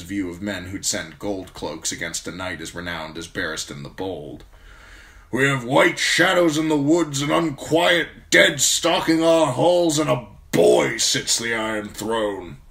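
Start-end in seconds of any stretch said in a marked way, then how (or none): 5.3-12.6 put-on loud, high old officer's voice, disgusted; disgusted old commander. A narrator's reading. Commander despairs of the realm's rulers, loud disgust. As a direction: build the list of woes into open disgust. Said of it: "he said in disgust".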